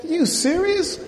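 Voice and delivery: high-pitched disbelieving question; incredulous. Acted. Preacher challenges those weeping all night, incredulous. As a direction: lift the pitch sharply, as if you can't believe it.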